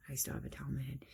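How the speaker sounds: whispered side comment; whispered aside. Real, not acted. Quick aside while doing makeup.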